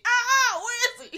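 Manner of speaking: high, loud, laughing squeal; thrilled cry watching the reveal; excited squeal. Retold or imitated. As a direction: shriek with glee at the screen.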